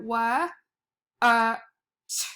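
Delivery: "wa, uh, t" as separate phonics sounds; sounded out in parts. Shown as a demonstration. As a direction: sound out "wa, uh, t" with gaps between. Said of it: "when you sound it out".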